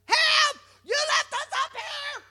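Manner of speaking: high, piercing cries for help; stranded child shrieking for help; screaming for help. Retold or imitated. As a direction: call out from the stuck ride seat. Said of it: "Both of us are screaming".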